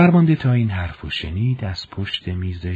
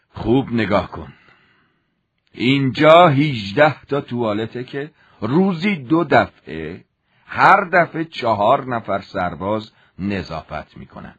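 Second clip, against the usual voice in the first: a hint of a threatening officer; stern commander; 3 semitones higher than usual. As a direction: lay out the punishment coldly.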